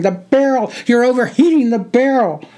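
very high, loud sergeant's yell; screamed warning. A narrator's reading. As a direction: scream the warning over machine-gun fire. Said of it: "he screamed".

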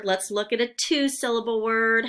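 ordinary delivery

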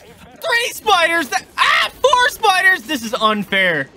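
high panicked screams; screaming at spiders. Real, not acted. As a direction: shriek at the spiders.

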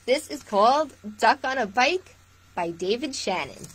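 lively storytime read-aloud; picture-book voice for little listeners; reading to children. Acted. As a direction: read it warmly to a room of kids. Reads picture book to viewers.

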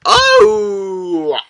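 long high "oh" of shock; startled yelp, stretched out; startled cry. Real, not acted. Startled by a loud game shock.

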